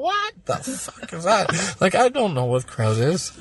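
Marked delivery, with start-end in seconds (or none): 0.0-1.8 put-on higher, slow, baffled young voice; confused teenage self. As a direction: play his bewildered fourteen-year-old self. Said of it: "And I'm sitting there going".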